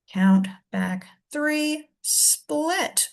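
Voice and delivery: slow and deliberate. Shown as a demonstration. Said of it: "teacher demonstrates counting".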